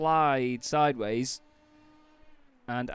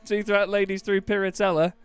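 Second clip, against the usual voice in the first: bingo caller; 5 semitones higher, 5 dB louder than usual. Pretend. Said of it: "Should be a bingo caller".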